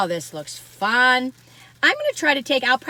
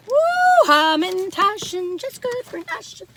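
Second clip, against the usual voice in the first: excited squeal; 10 semitones higher, 4 dB louder, slower than usual. Real, not acted. Excited squeal over baked goods.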